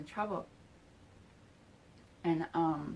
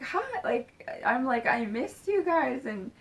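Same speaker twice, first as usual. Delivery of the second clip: high, raised-voice imitated reunion squeal; excited greeting; 7 semitones higher, 6 dB louder than usual. Retelling the reunion on her lap, excited greeting. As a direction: burst out high, as if thrilled to meet. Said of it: "we're just excited".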